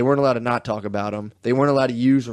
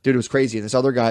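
usual speaking voice

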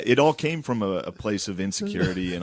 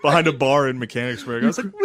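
usual speaking voice

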